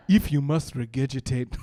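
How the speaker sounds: put-on formal, deliberate African-accented English; cab driver's African accent. African driver finally giving in to drunk passenger, formal accent. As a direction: pronounce it grandly in an African accent. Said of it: "says a big word in a funny accent".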